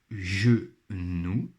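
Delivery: "genou" slowed into ge, pause, nou; syllable by syllable. Shown as a demonstration. Teacher pointing out a sound in the word, syllable by syllable. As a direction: sound out "genou" in two beats. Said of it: "dans la première syllabe".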